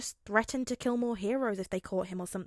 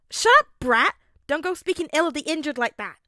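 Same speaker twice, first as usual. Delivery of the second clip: high, loud scolding shout at her son; mother yelling; 7 semitones higher, 12 dB louder than usual. A narrator's reading. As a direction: snap him into line at full volume.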